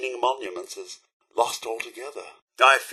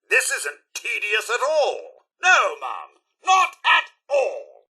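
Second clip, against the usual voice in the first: exaggerated, drawn-out insistence; sarcastic denial; 5 semitones higher, slower than usual. Fed-up companion reassuring Mum, heavily sarcastic. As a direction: deny it so the boredom shows through. Denies being bored, meaning opposite.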